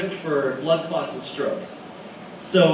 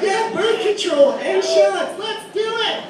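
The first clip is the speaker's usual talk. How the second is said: put-on high, slow giddy girlish voice; excited girls; slower than usual. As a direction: gush like two eager girls. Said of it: "Two girls like".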